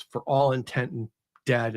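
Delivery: ordinary talk, nothing marked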